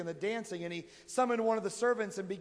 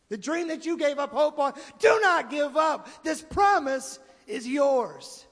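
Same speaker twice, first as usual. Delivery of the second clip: slow, much louder and higher preaching shout; shouted exhortation; 7 semitones higher, 10 dB louder, slower than usual. Real, not acted. Preacher urging the congregation not to give up, shouting. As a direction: drive it home to the whole congregation.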